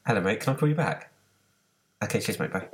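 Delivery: quick brush-off on the phone; dodging a caller. Real, not acted. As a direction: hurry the caller off politely. Phone rings mid-recording, call deferred.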